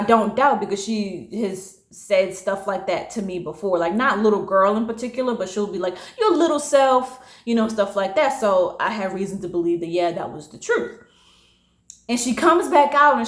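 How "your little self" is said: higher-pitched mock put-down; mimicked belittling. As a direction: imitate a condescending boss. Manager's belittling remark imitated.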